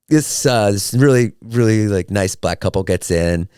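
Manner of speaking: ordinary talk, nothing marked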